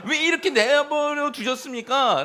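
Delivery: half-laughing cry of blame at God; resentful wail. Retold or imitated. Believer wailing at God for abandoning him, hammed up. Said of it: "라고 원망하잖아요".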